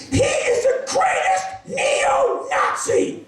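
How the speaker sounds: very high, drawn-out ecstatic shriek; yelling out. Retold or imitated. Woman telling her friend who he is, ecstatic yelling. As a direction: shriek it slowly for the whole room. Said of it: "when you yell out "neo-Nazi" at great volume".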